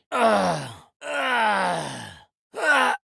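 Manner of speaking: high cry of pain. Acted. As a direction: cry out as if hit. Combat effort and pain sounds. (cri de douleur aigu)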